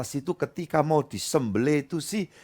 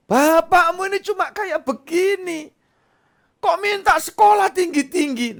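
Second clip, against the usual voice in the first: high, louder grumbling dad voice; self-pitying father; 5 dB louder than usual. Acted. Father refuses child's wish for higher school, high and grumbling. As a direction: complain you're too poor for school.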